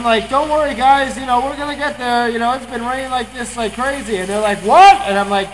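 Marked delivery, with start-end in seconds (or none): 0.3-4.2 loud, high retold reassurance; raised voice over rain. Calling over pounding rain in car. 4.6-4.9 loud, high imitated "can't hear you" shout; shouted question. Cannot hear over the rain.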